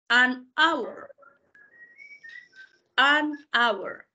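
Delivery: "hour" sin H, empieza con vocal; H muda. Shown as a demonstration. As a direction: di "An hour" callando la H. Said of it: "esa H es silenciosa, es muda". ("An hour" con la H muda)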